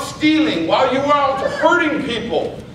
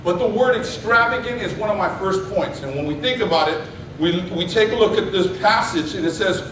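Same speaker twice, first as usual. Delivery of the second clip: ordinary talk, nothing marked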